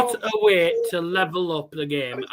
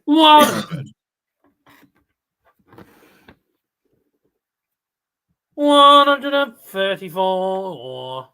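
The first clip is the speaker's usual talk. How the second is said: darts caller voice; 3 semitones higher, 7 dB louder, much slower than usual. Pretend. Mimics darts caller announcing score.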